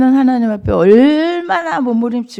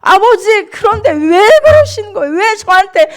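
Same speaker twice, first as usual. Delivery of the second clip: high, loud wailing protest, re-enacted; tearful resentful outcry; 10 semitones higher, 7 dB louder than usual. Believer confronting the Father in prayer, tearful outcry. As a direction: cry out why, hurt and accusing. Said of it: "막 이러면서 울고".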